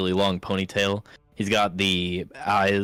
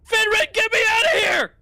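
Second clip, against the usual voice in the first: high, loud yell to a friend; shouting for help; 7 dB louder than usual. Acted. Prisoner calls Finren to free him, urgent acted shout. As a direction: holler to be let out, pitched way up. Gnome yelling to friend for rescue.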